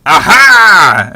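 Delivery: very loud, high, gloating exclamation; loud, triumphant aha from a newly arrived schemer. Acted. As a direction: burst in with a gleeful reveal. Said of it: "you just hear".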